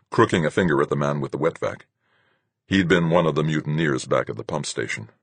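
usual speaking voice

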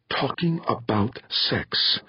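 slow, tight angry hiss; a mother's furious hiss at her daughter. A narrator's reading. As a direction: hiss it through clenched anger, slowly. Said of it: "Pauline hissed".

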